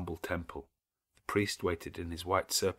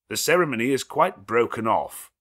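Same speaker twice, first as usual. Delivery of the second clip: loud, higher, firm declaration; stranger objecting; 4 semitones higher, 9 dB louder than usual. A narrator's reading. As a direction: shut the wedding down. Stranger voiced in novel.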